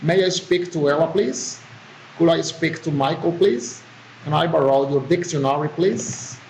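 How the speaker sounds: "please" swept sharply upward after each request; rising request intonation. Shown as a demonstration. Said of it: "Request, rising intonation".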